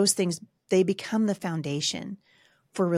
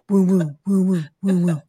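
loud imitated "woo woo" of a running mixer; mixer whirring; 5 dB louder than usual. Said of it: "So the mixer's like".

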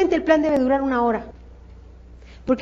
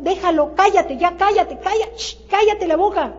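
higher, insistent hushing with a shh; urgent hushing; 4 semitones higher than usual. Acted. Coach hushing a recruiter who talks over the prospect, urgent. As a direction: cut them off, pitched up and insistent.

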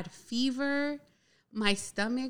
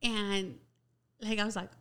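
normal talking voice

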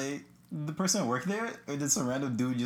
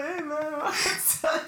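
high, laughing squeak of a greeting; squeaky mock greeting; 14 semitones higher, much slower than usual. Friend mocking his own court chatter, high and giggly. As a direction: play it up in a silly high voice.

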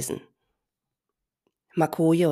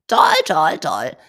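loud croaking birds; 10 dB louder, slower than usual. Acted. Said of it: "krächzten die anderen Madenhacker".

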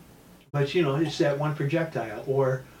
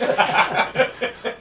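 high breathy laugh bursting out; burst of laughter; 10 semitones higher, 6 dB louder than usual. Real, not acted. Teacher laughs hard recalling kicking the kid, bright outburst. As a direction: laugh out loud at the punchline.

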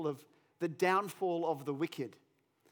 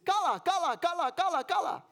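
high, loud Finnish crowd chant; cheering onlookers; 10 semitones higher, 5 dB louder than usual. Retold or imitated. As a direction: cheer the angler on, high-pitched. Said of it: "all the people standing around are saying".

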